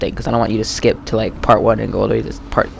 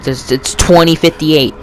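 ordinary talk

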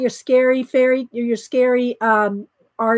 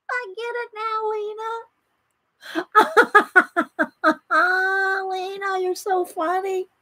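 high, much softer, wheezing with laughter; breathless laughing; 7 semitones higher, 4 dB quieter than usual. Real, not acted. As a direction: barely get the words out for laughing. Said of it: "Now my eyes are watering".

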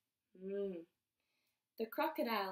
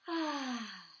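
relaxed sigh. Shown as a demonstration. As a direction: demonstrate a long relaxing "ah". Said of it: "Like a relaxing sound, like".

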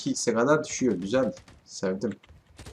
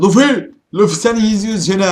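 loud, high and quick to a teammate; calling out; 8 semitones higher, 9 dB louder than usual. Real, not acted. Gamer calling teammate Luffy mid-match, loud and fast. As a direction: shout his name then rush on.

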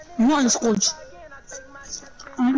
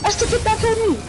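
excited; 8 semitones higher, faster than usual. Real, not acted. Excited repeat in game.

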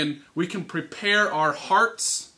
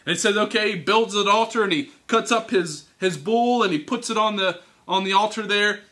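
usual speaking voice

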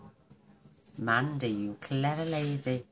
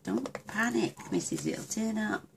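higher, sing-song soothing reply; mock reassuring; 8 semitones higher than usual. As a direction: play yourself calming her down, lightly. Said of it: "I'm like".

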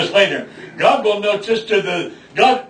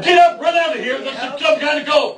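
high, urgent demon command; demonic voice; 7 semitones higher than usual. Retold or imitated. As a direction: bark it like a demon telling them to flee. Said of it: "The voice said".

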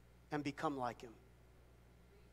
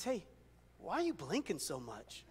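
whispered classroom question; 5 semitones lower, 10 dB quieter than usual. Retold or imitated. Friend leans over in class.

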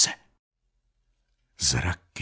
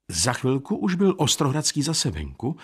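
plain reading voice

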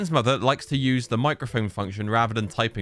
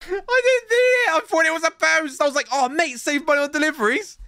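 mock excited squeal; 4 dB louder than usual. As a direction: play up the false excitement, hammed-up. Excited about a fake post.

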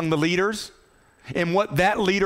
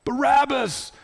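crowd calling out; 7 semitones higher than usual. Acted. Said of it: "what does the crowd say".

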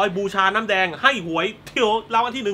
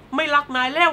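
mock childish rejection; 8 semitones higher than usual. Andy rejecting Chucky before burning him, mock childish rejection. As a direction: turn on a friend like a hurt kid.

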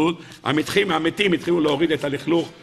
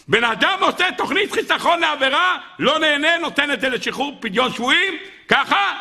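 high, marvelling proclamation; impassioned exclamation; 12 semitones higher than usual. A narrator's reading. Preacher marvels to listeners at the sacrifice, impassioned exclamation. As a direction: preach the climax in a sustained raised pitch.

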